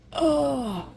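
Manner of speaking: soft, high pained "oh"; groan of pain. Real, not acted. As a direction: let out a real, quiet moan of pain. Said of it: "my foot is killing me".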